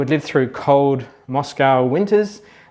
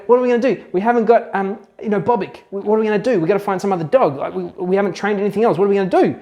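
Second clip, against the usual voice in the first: higher-pitched mock panic in an attempted Russian accent; freaking out; 4 semitones higher, faster than usual. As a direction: play the flustered scientists, voice pitched up. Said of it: "they're kind of freaking out".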